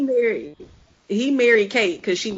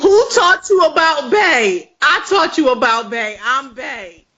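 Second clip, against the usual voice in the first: sassy diva boast; 7 semitones higher, 6 dB louder than usual. Pretend.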